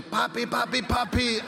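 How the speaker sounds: put-on high, excited child calling papi; excited kid. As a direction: imitate a thrilled little kid running to dad. Twins imitating son greeting him.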